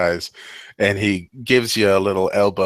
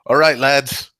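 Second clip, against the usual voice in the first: higher, louder barkeep call; bartender addressing crowd; 7 semitones higher, 4 dB louder than usual. Acted. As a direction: call the lads to order. Bartender quieting crowd after bell.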